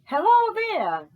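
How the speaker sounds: normal talking voice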